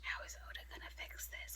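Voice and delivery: hushed aside to oneself; whispered worry. Retold or imitated. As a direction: drop to a very soft whisper. Voices worried inner thought.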